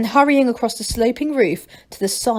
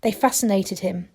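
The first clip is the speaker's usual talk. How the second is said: ordinary delivery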